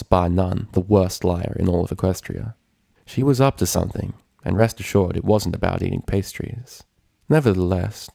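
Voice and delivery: ordinary delivery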